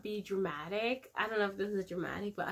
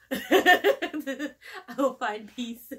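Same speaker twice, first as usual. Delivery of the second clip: high, louder, laughing through the words; 6 semitones higher, 5 dB louder, much slower than usual. Real, not acted. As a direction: laugh it out, amused by herself. Joking about dying, laughing.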